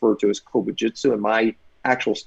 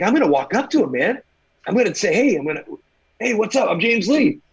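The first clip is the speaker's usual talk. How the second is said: excited self; 8 semitones higher, 3 dB louder, faster than usual. Retold or imitated. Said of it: "I'm like".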